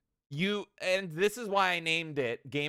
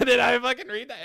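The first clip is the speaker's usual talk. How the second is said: higher, louder, fast disbelieving laugh-line; laughing incredulous reread; disbelief; 8 semitones higher, 7 dB louder, faster than usual. Retold or imitated. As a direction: blurt it in shocked disbelief. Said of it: "then I was like".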